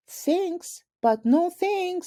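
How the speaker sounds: high, sassy brush-off; cheeky girl voice. Acted. Ivy declining the principal's invitation, high sassy girl voice. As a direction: toss off a bright cheeky refusal.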